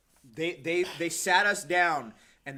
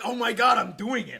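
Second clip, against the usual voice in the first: excited realization; 7 semitones higher, 8 dB louder than usual. Retold or imitated. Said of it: "excited at fluency".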